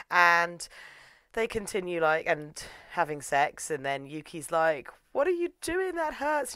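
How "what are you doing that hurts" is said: high-pitched complaint; mock pained protest. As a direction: whine it high, as play-acted hurt.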